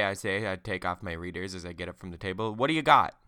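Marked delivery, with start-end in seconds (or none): 2.5-3.2 call back. Acted. Husband answers wife's call.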